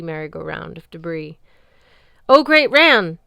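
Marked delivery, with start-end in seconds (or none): 2.3-3.2 high, loud, terrified wail. Acted. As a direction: wail it, begging for your life. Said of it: "Harald wailed".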